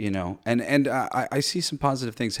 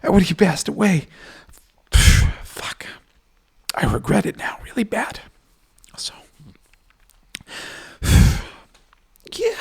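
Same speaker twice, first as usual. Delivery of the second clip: crying; 8 semitones higher, 6 dB louder than usual. Real, not acted. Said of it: "which is The reason why I cry".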